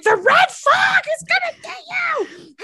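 very high, drawn-out wail; hammed-up alarm cry, stretched out slowly; mock panicked warning. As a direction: cry out a comic doom warning. Acting out panicked in-game warning.

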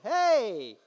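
haughty, indignant retort; high, huffy mock outrage; pompous. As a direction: act offended at being forgiven. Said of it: "one of the most pompous responses".

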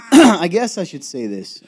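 high, laughing and loud. Real, not acted. Co-host teases a secret, laughing loudly. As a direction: blurt it out through laughter.

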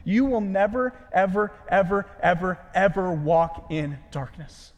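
a preacher hammering home a promise; emphatic never. Shown as a demonstration. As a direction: pile up every "ever" with growing weight. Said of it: "It literally means something like this".

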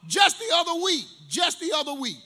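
loud, high excited cry; excited shout. Real, not acted. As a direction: shout it out to the congregation. Building sermon story.

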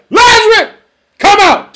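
very high, loud shout; shouted summons. Acted. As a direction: call out the dead with full force.

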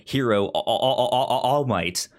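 ordinary delivery

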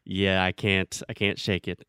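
ordinary talk, nothing marked

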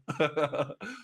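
a short real chuckle at himself; brief amused laugh. Real, not acted. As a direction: laugh it off lightly. Laughing at his cheesecake habit.

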